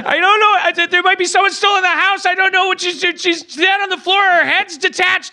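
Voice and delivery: high, frantic and breathless; panicked caller. Acted. Panicked caller in murder improv.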